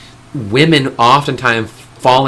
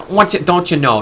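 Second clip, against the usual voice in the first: higher, put-on Alaskan accent; mock Alaskan accent; 7 semitones higher than usual. Mocking an Alaskan politician's catchphrase, imitated Alaskan accent. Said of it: "she has a weird accent".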